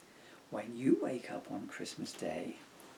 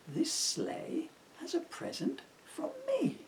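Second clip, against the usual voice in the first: put-on little girl voice; 11 semitones higher than usual. Emily sweetly asks Santa to take the sleigh.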